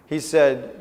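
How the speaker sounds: ordinary talk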